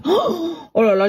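high gasp of self-reproach; mortified exclamation. Acted. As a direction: cringe aloud at your own blunder. Mortified by her own reply.

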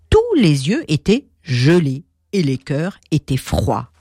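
ordinary delivery, nothing marked

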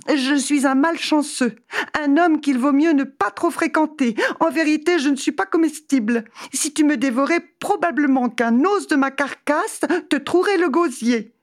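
high-pitched, frightened plea; terrified pleading. Acted. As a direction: beg the beast for mercy, high and scared. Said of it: "Il fut tant effrayé".

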